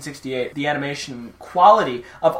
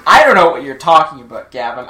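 louder, indignant jokey protest; mock offended; 8 dB louder than usual. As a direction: feign outrage at the insult.

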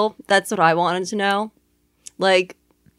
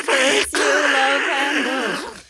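duck voice; 5 semitones higher, much slower than usual. Acted. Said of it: "in the Donald Duck voice".

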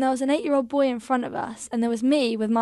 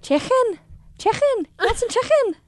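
high-pitched Scouse impression; Liverpool accent; 11 semitones higher than usual. Shown as a demonstration. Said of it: "Can you do a Liverpool accent?".

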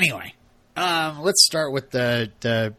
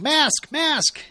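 high, louder mock yell; imitated yelling; 5 dB louder than usual. Kirk in a creepy house, imitated high yell. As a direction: call it out twice, imitating Kirk's panicked yell. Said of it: "Kirk starts yelling".

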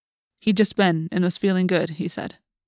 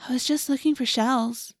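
lightly voiced meek girl; meek girl's voice; 6 semitones higher than usual. A narrator's reading. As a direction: play innocent in a higher voice. Narrator voices Cassie answering policeman.

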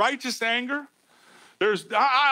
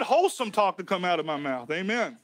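ordinary talk, nothing marked

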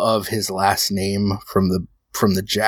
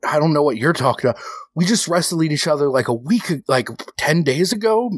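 higher, fast, rising anger; heated accusation; 5 semitones higher than usual. Acted. Rocco accusing Johnny of stalking him, higher and fast. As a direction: push harder and faster.

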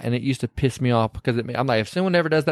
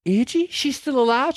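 surprised; 10 semitones higher than usual. Retold or imitated. Evelyn surprised.

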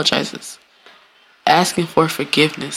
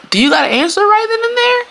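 incredulous high question. Real, not acted.

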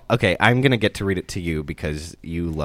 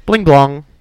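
high loud chime; computer chime noise; 8 semitones higher, 11 dB louder than usual. Pretend. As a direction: belt out a startup jingle. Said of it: "Ready? Here we go".

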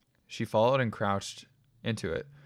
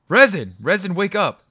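loud urgent shout; calling loudly; 10 semitones higher, 12 dB louder than usual. A narrator's reading. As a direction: shout to wake him, rising in force. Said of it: "I heard the voice call louder".

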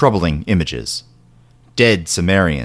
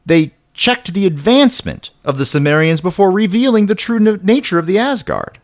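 excited; 10 semitones higher than usual. A narrator's reading. Excited build in narration.

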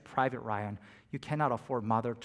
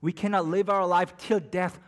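ordinary talk, nothing marked

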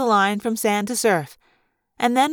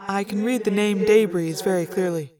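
measured, matter-of-fact lookout report; Axe, formal and precise; 2 semitones lower than usual. Acted. Axe reports who is on the boat, formal and precise. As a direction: report it evenly, item by item, in character.

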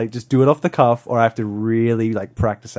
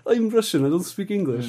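higher-pitched, lightly played Russian accent; mock Russian accent; 6 semitones higher than usual. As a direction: imitate an older Russian struggling with English. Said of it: "he's kinda like this older, like".

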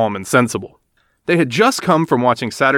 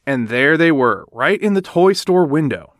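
ordinary delivery

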